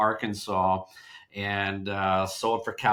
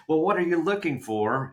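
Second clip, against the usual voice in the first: awkward; 7 semitones higher than usual. Retold or imitated. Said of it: "kind of awkward to say".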